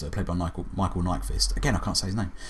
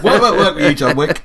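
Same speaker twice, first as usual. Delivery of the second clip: higher, louder, breaking up with laughter; laughing; 7 semitones higher, 8 dB louder than usual. Real, not acted. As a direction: struggle to get it out while laughing. Still laughing at the joke.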